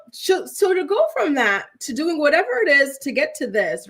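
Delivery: usual speaking voice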